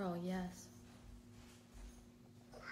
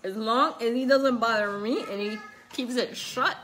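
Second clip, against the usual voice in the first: louder, slowed at first; teasing; 5 dB louder than usual. Real, not acted. Telling her baby to keep working, loud teasing. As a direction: cheer him on wryly.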